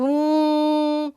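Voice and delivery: long steady hum; sustained humming engine noise; aeroplane drone. Shown as a demonstration. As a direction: imitate a plane droning overhead. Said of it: "ਅਸੀਂ ਬੋਲਦੇ ਹਾਂ".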